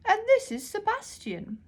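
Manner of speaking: a grand, half-deaf librarian calling out. Acted. Deaf librarian shouts while holding her ear trumpet.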